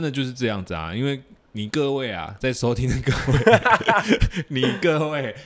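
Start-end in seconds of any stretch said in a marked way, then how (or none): 3.4-4.4 high burst of laughter; loud laugh. Real, not acted.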